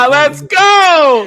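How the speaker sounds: loud, high gleeful shout; excited cheer. Real, not acted. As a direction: cheer it out like a fan. Streamer cheers at arrest.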